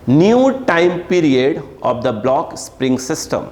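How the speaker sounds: slow, drawn-out dictation. Real, not acted. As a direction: read it out slowly for note-takers. Teacher dictating a question to write. (धीरे-धीरे सवाल लिखवाते हुए)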